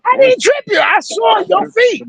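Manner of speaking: put-on high, shouted little-girl protest; daughter's shouted denial. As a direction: yell it back the way his daughter did. Daughter voiced shouting back.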